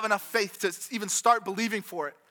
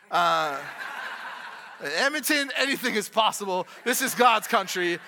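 laughing. Real, not acted. Joke about Vancouver prices.

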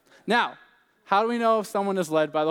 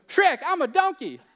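high-pitched excited cartoon sidekick impression; comic movie-character squeal, played for laughs; Donkey from Shrek; 11 semitones higher, 3 dB louder than usual. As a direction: call it out high like a goofy talking donkey. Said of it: "that was my best impression".

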